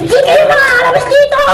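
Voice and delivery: high loud frantic shout; escaping the tiyanak, shouting high. Acted. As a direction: push everyone out in a panic. Fleeing the tiyanak.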